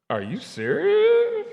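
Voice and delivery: very high, slow squeal of disbelief; mock astonishment. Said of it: "mock astonishment at own news".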